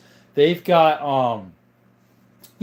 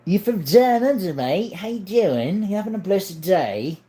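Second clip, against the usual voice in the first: higher, put-on Australian accent; pitched-up Aussie impression, bouncy; Steve Irwin; 4 semitones higher than usual. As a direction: greet a mate in rough-and-ready Australian. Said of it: "give me your best Steve Irwin impression".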